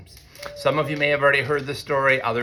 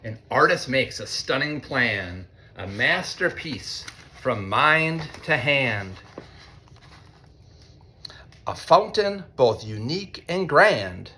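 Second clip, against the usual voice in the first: lightly sing-song storybook reading; reading to children; slower than usual. A narrator's reading. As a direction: read it like a picture book to a class. Reads picture book to students.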